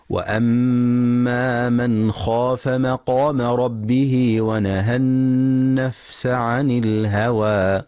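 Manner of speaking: slow, measured tajweed chant; Quran recitation. Acted. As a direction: recite it slowly and melodically.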